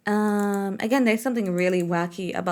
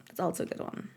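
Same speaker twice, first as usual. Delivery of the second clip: softer, breathy whispered repeat; whispered echo; 5 semitones lower, 11 dB quieter, faster than usual. Shown as a demonstration. Streamer echoing their own remark in a whisper.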